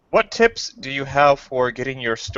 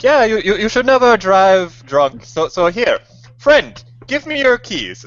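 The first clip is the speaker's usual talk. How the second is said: much higher, louder and slow; mock ad character; 11 semitones higher, 6 dB louder, slower than usual. Retelling designated-driver ad, high hammed-up voice. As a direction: ham up a smug sober friend. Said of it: "he's just like".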